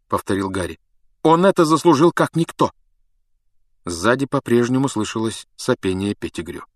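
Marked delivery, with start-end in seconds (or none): 1.2-2.7 high, loud, firm insistence; insistent boy. A narrator's reading. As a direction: declare it forcefully in a raised voice. Harry insisting on his verdict. (высокий настойчивый голос)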